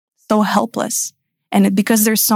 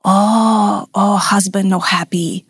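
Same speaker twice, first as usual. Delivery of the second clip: Balinese masseur; much slower than usual. Retold or imitated. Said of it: "he goes".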